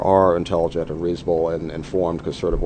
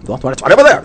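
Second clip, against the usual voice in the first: exasperated; 8 semitones higher, 9 dB louder, faster than usual. Retold or imitated. Professor insists to student.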